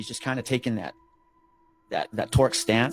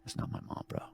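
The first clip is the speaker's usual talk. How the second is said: put-on hushed kid voice; 13 dB quieter than usual. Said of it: "I go".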